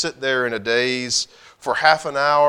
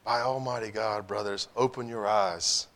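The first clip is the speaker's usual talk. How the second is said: quiet, earnest appeal; solemn hush; 4 semitones lower, 8 dB quieter than usual. A narrator's reading. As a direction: urge the brothers softly.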